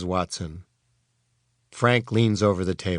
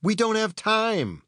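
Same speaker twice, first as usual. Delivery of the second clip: high, exasperated protest; mild yell; 10 semitones higher than usual. A narrator's reading. Frank halts the arguing robots with a mild yell. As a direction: cry it out without full volume. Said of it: "yells Frank".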